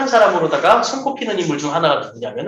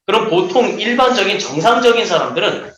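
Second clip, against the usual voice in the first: usual speaking voice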